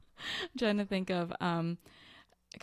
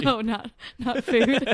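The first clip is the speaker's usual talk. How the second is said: laughing; 4 semitones higher, 11 dB louder than usual. Real, not acted. Laughing correction after food answer.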